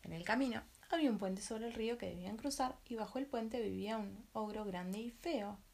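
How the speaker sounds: ordinary delivery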